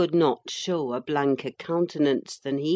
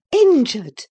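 "Injured!" flung out much higher and louder, falling; angry cry; 9 semitones higher, 10 dB louder than usual. A narrator's reading. Nurse flinging the father's word back at him, outraged. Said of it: "I cried angrily".

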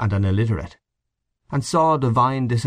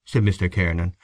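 ordinary delivery

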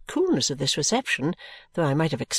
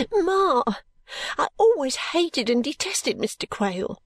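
high, slow tearful protest; acted weeping daughter, pitched high; sobbing; 7 semitones higher, slower than usual. A narrator's reading. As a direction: protest to mother through sobs. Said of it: "sobbed Caddy".

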